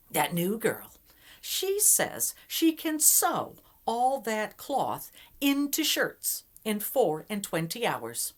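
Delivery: hammed-up jealous servant tattling; gossiping servant. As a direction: spread the rumor with relish. Servants spreading a jealous rumor.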